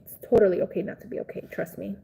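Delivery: usual speaking voice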